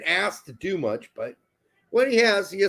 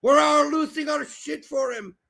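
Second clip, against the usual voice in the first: hype shout; 9 semitones higher than usual. Real, not acted. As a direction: hail him like a demon hero. Hyping the goal scorer.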